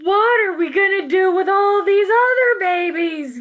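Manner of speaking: exasperated father calling out; yelling. Acted. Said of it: "yelled Kristen's father".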